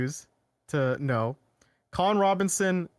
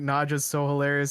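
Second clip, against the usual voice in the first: ordinary delivery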